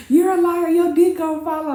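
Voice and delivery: high-pitched retold insults; mock taunting accusation. Acted insults hurled at the man.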